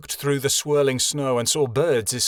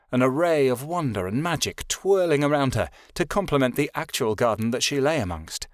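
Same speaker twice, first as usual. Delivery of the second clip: ordinary delivery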